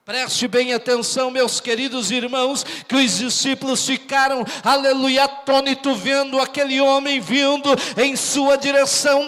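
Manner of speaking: loud, high, urgent storytelling; fervent preaching. Real, not acted. Preacher tells brethren of the astonished disciples, loud and urgent. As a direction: drive the story forward at high pitch.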